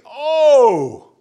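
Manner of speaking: OH cried out high, loud and drawn out; a long, loud, soaring OH; emphatic cry. Shown as a demonstration. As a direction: exclaim OH with full force. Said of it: "That's what the word is".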